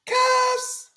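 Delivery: very high sung-out cuffs; high squeal cuffs. Acted. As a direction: call it out in a high falsetto. Sound-effect call.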